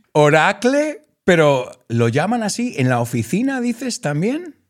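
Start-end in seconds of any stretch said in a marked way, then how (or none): 0.1-1.0 surprised repeat. Real, not acted.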